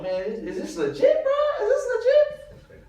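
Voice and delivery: rising, incredulous and high; can't believe this is a real movie; amazed disbelief. Retold or imitated. Extra on set asking his buddy, stunned and high.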